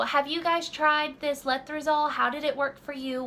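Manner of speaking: ordinary delivery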